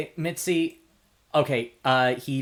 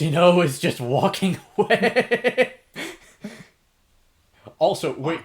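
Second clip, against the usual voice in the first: louder, higher, laughing through the words; 6 semitones higher, 7 dB louder than usual. Real, not acted. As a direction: laugh while you narrate. Players laughing at the scene.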